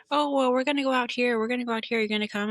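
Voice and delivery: high, singsong imitation of partying friends; a hint of eager party friends; mock friends' invite. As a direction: lightly mimic the friends calling him out. Friends inviting him out, mocking.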